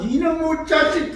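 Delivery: high-pitched mock scolding; acted flash of a father's temper; angry father. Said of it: "화가 나고".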